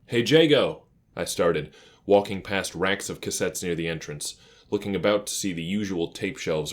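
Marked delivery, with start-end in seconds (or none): 0.1-0.9 louder, higher call across the shop. A narrator's reading. Kit calling to Jago entering the shop, loud friendly hail.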